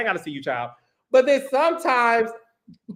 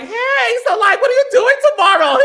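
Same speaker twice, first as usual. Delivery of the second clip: much higher, louder, fast flirty voice; high feminine voice; 13 semitones higher, 6 dB louder than usual. Shown as a demonstration. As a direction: squeal it out high and quick. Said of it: "I could approach a man like".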